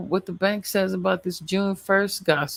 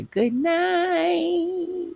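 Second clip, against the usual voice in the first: high sing-song goodnight. Acted. Sign-off in high voice.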